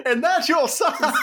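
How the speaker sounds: high, laughing Southern catchphrase; hammed-up Southern comic tag; Southern accent. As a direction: land the catchphrase in a put-on Southern twang. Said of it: "I found the accent now".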